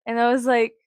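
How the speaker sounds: ordinary talk, nothing marked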